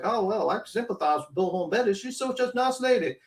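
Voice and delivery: put-on sympathetic juror, rising high at the end; mock-sweet voice of a won-over juror; sympathetic juror impression. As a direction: gush with fake sympathy. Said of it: "they're gonna be like".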